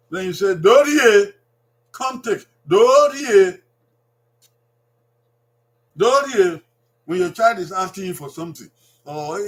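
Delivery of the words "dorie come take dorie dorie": Dorie called out loud and falling; loud drill. Shown as a demonstration. Teacher calling Dorie out for families to repeat. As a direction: sing out Dorie so they copy it. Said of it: "teacher models Edo word loudly".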